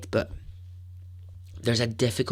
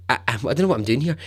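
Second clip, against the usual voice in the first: ordinary delivery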